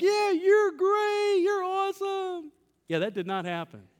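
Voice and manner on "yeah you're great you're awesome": high, slow, dreamy movie-wife voice; swooning wife. Retold or imitated. Imagined wife swoons at the door, much higher and slow. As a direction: melt into the words like a Hollywood heroine. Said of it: "she'd be like".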